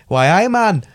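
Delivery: loud, high mock Geordie "Why, aye, man"; loud Geordie mimicry; Geordie impression. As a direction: imitate his Geordie accent, hammed up. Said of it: "you're doing more like".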